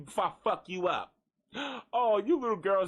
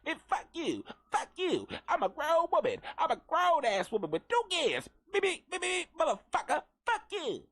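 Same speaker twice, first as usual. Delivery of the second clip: cuss like a tiny woman far below; tiny squeaky cussing; 10 semitones higher than usual. Retold or imitated. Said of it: "All you heard was a bunch of squeaking".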